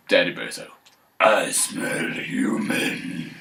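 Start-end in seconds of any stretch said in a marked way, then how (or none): 1.2-3.3 slow, low menacing whisper; growly whisper. Acted. Daddy bear sniffing out a human, slow whisper. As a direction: breathe it out like a sniffing monster.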